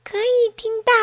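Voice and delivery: put-on high-pitched baby reply; baby piping up from the belly. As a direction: answer in a little high voice.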